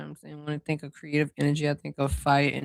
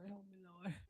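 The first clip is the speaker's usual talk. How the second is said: muttered aside; 3 semitones higher, 28 dB quieter than usual. Real, not acted. Mutters plea after loud cry.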